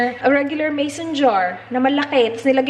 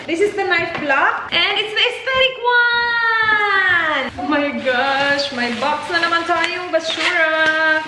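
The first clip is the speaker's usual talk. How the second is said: high, slow delighted gush; gushing excitement; 6 semitones higher, slower than usual. Real, not acted. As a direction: swoon over the pretty knife block. Unboxing the aesthetic knife block. (kilig na kilig na boses)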